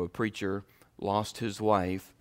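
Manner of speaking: ordinary talk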